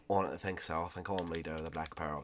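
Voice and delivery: soft, low rival pirate voice; quietly dispute who leads the ship; Jack Sparrow. Pretend. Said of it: "Shut up, Jack Sparrow".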